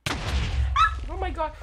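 loud, drawn-out, high shriek of fright; real fright, a slow, high scream; startled scream. Real, not acted. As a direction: yell it like you just got jumped. Startled by a sudden attack.

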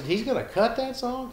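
high-pitched incredulous question; acted disbelief. Songwriter hears his song will be recorded, incredulous. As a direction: replay the shock, voice shooting up. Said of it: "I couldn't believe it".

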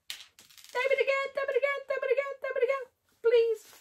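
begging the viewer to repeat the comment; pleading sing-song. Real, not acted. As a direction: whine to have it typed again. Begging viewer to retype message.

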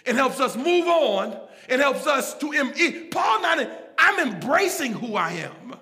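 loud, driving pulpit delivery; full-force preaching. Real, not acted. Preacher driving home grace to his congregation, full-force preaching. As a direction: preach it hard, punching key words.

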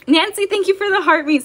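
ordinary delivery

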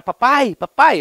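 imitating his little daughter. Daughter's babbling voiced by dad.

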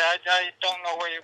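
normal talking voice

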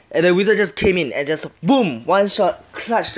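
loud, high, thrilled retelling; real buzz after a clutch win; excited. Real, not acted. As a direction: punch it out with winner's excitement.

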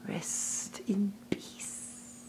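soft slow whispered threat; menacing whisper. Acted. Kaa whispering over the hypnotized man cub, slow menacing whisper. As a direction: breathe it out like a snake closing in.

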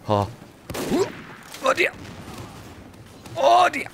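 very high squealed exclamation; mock alarm. Gamer reacting to trouble in the match, high mock alarm.

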